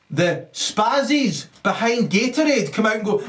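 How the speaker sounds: ordinary delivery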